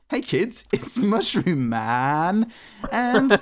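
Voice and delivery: put-on high, slow, hammy kids' presenter; kids' TV host. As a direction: announce it like a cheesy kids' host. Mock kids' show host intro.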